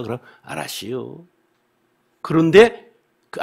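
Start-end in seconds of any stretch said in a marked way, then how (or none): 0.4-1.3 soft, vague Chungcheong "알았시요" with a lifting end; noncommittal Chungcheong reply. Retold or imitated. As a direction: mumble "알았시요" like an evasive Chungcheong villager. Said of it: "알았시요"가 뭔지를 아는데".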